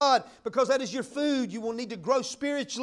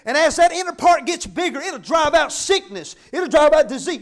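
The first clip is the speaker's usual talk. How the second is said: louder, driving the words out; rising preaching; 2 semitones higher, 11 dB louder than usual. Acted. Preacher to church on healing, loud and driving. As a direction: build it like a preacher's climax.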